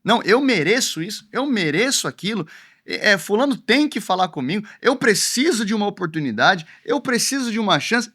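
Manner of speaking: high-pitched mock entitlement; entitled. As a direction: mimic someone demanding their due. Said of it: "acham que outros os devem".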